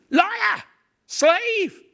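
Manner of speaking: very high, loud raging cries; furious shout. Acted. Macbeth screaming at the messenger, full fury. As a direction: hurl each word at him.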